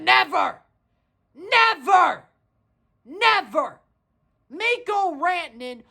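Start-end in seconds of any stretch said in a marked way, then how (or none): 0.0-3.8 shouted never. Real, not acted.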